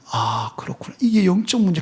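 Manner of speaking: hushed note-to-self; quiet realization. Acted. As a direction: say it inwardly, as it dawns. Believer inwardly realizing the problem.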